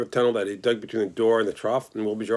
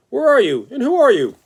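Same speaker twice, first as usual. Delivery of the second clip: much higher and louder, calling into the dark; startled calling out; 13 semitones higher, 8 dB louder than usual. Acted. The pig calls out to the hidden voice, high and loud. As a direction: shout out wildly to the unseen voice.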